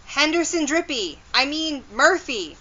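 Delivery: high-pitched, hurried call. A narrator's reading. Nancy races after Henderson, calling out high and quick. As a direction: call after someone getting away. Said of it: "Nancy called".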